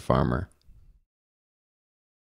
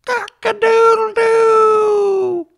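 very high, loud, slow crow; rooster crowing with a shout; 6 dB louder, slower than usual. A narrator's reading. Rooster crowing all together with the farmyard, shouted. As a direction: crow as loud as you can. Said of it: "the rooster crowed".

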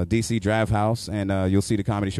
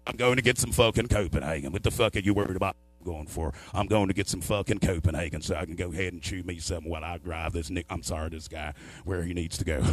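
lower, softer mock country driver; 6 semitones lower, 6 dB quieter than usual. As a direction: grumble like a tobacco-chewing hillbilly. Redneck driver voiced.

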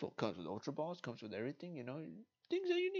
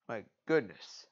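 normal talking voice

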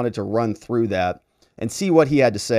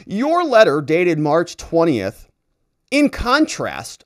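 slow and much higher, sneering at every phrase; pompous mock reading; 5 semitones higher, 4 dB louder, much slower than usual. Host sarcastically reads the DA's reply aloud, slow and high. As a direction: stretch it out like a self-important official.

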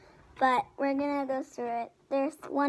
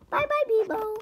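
tiny hippo voice; 8 semitones higher than usual. Acted. Hippo says goodbye.

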